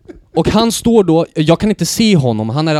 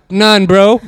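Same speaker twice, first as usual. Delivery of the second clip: higher, louder, guilty brush-off; caught roommate; 6 semitones higher, 9 dB louder than usual. Retold or imitated. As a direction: shrug it off as if nothing happened. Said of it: "Han bara".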